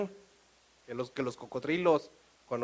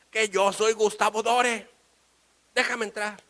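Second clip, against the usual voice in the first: slow, loud, high protest; indignant insistence; 7 semitones higher, 7 dB louder than usual. Acted. Painter demanding entry into France, indignant insistence. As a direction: hammer out your name, exasperated.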